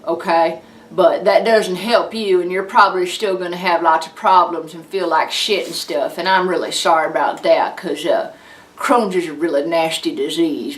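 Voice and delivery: blunt backwoods Southern sympathy. Acted. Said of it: "Southern redneck".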